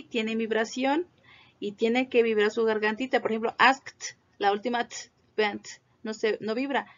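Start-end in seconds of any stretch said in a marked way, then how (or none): none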